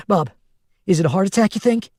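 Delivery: frightened. A narrator's reading. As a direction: ask it scared and urgent. Lily frightened asking Bob.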